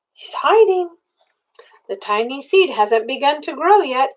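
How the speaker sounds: ordinary delivery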